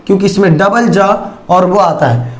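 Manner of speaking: ordinary talk, nothing marked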